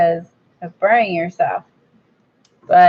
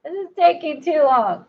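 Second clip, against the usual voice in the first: high, huffy impatient voice, lightly played; a mock hurry-up whine, as if the glue takes forever; 9 semitones higher than usual. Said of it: "Trying to be like".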